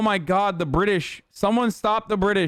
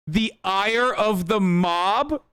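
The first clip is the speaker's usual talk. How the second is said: slow, higher, disbelieving echo; incredulous; 4 semitones higher, much slower than usual. Real, not acted. Streamer repeating news anchor's phrase, incredulous. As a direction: stretch it into a drawn-out question.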